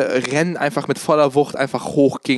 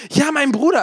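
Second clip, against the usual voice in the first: high-pitched mock yell, only slightly raised; nachgeahmtes Schreien; 8 semitones higher than usual. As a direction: imitate her repeated cry up high. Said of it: "dann schreit sie nochmal". (hoch nachgeahmter, halblauter Schrei)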